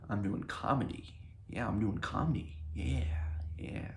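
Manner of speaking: put-on soft, whispery old man; Clueless Weatherman voice. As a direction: mumble it like a lost old man. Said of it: "the Clueless Weatherman voice".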